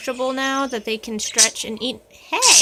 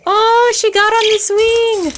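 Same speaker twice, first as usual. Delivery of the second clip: excited; 10 semitones higher, 8 dB louder than usual. Real, not acted. Bird jumps on swing.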